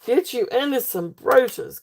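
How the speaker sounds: usual speaking voice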